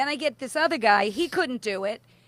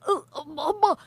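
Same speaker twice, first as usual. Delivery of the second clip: mock panic; 7 semitones higher, 4 dB louder, faster than usual. Mock panic over the fire news.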